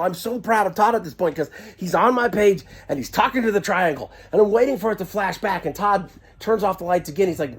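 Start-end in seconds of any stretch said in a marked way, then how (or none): none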